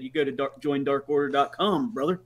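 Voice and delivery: low, soft wrestler-promo voice, ending on brother; mock wrestler promo. Dad answers son, wrestler-style.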